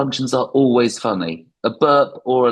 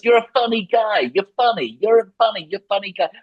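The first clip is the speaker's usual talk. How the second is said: Goodfellas gangster; 8 semitones higher than usual. Retold or imitated. Comic mimicking the Goodfellas gangster, mock menacing. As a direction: needle him like the mobster in Goodfellas, pitched up. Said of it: "the thing in Goodfellas".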